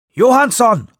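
hoher, lauter Ruf über den Lärm. Acted. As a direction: ruf ihn hoch und laut herbei. Ruf nach Johansson im Sturm. (lauter Ruf nach Johansson)

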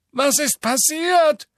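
high, slow, near-whimper; almost whimper it. A narrator's reading. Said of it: "fügte er fast wimmernd hinzu".

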